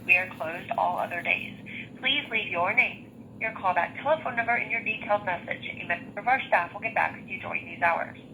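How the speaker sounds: ordinary talk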